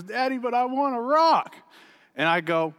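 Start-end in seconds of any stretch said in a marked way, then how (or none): 0.0-1.6 put-on whining child. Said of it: "He starts whining and goes".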